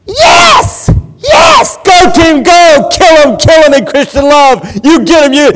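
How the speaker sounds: screaming high and loud, wild stadium fan; fanatic fan cheering. Pretend. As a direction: yell like a crazed football fan on his feet. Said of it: "I would be saying things like".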